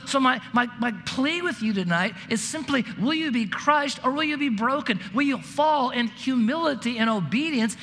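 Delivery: fervent preacher urging the congregation to be broken; preaching at full force. Acted. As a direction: drive the plea home from the pulpit.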